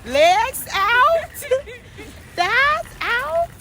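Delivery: hyped shout. Real, not acted. Hyped chant in car.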